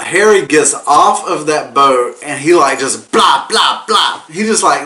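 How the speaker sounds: ordinary talk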